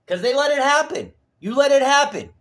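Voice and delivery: high, loud accusing shout; angry accusation. Real, not acted. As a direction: hurl the blame at them in a yell. Furious accusation of investigators.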